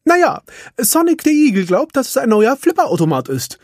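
eager little handyman answering Ralf; Felix. A narrator's reading. Felix answers Ralf, high and quick. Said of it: "meinte kein anderer als Felix".

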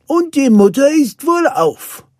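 high, loud, relieved cry; joyful announcement. A narrator's reading. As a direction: shout the happy news. Nanny's joyful news, then a hug. (freudig rufend)

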